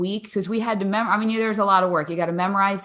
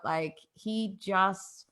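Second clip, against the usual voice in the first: ordinary talk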